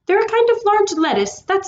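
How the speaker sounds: high, squeaky little rabbit. A narrator's reading. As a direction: chirp it like a small rabbit. Rabbit speaks.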